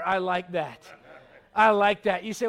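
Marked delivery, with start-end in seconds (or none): 1.5-2.2 louder, relishing the sweet smell; God savoring the offering, said louder. Retold or imitated. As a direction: lean in and say I like that louder, delighted. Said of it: "And the Lord's going".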